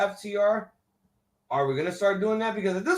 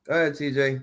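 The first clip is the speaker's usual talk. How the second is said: ordinary talk, nothing marked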